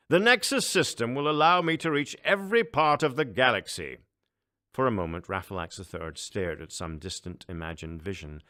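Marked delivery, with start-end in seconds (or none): 0.1-4.0 much higher, louder pompous emperor; a booming, self-important ruler. Acted. Emperor boasts.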